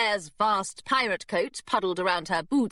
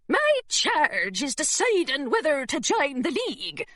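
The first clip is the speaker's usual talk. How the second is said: put-on governess putting on a rough pirate accent; thick pirate accent; 6 semitones higher than usual. Governess fooling the secretary in a thick pirate accent. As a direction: growl it like an old sea dog, in a pirate accent. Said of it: "in a thick pirate accent".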